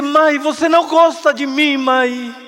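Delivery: high, loud anguished cry; hurt child's loud reproach; desperate plea. Acted. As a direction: cry out to mother from his knees. Kneeling boy begs mother.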